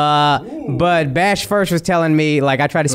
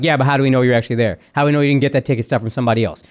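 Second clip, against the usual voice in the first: fast doubting questions; 4 semitones lower, faster than usual. Retold or imitated. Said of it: "they just kept being like".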